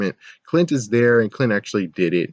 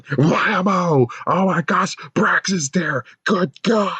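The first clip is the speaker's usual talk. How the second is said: hammed-up monster; 10 semitones higher, slower than usual. Imagined monster shouting.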